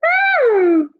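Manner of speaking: very high, loud woo; high whooping glide; voice thrown high. Shown as a demonstration. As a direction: swoop the voice up high. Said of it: "throw my voice up in the air".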